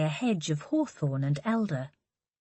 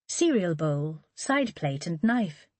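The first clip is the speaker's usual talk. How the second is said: plain reading voice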